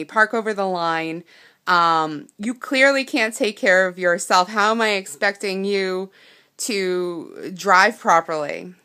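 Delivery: loud scolding rant, the last words slowed; angry yelling. Retold or imitated. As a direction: lay into her like an outraged stranger. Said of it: "yelling, full of fire, angry, angry, angry woman".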